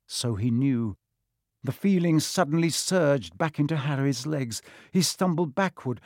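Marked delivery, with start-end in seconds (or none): none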